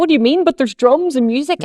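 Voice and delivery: put-on high, loud, pleading child voice; six-year-old girl. Child asking her mother why she can't see the parade, high pleading. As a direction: protest like a confused little kid. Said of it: "and I was like".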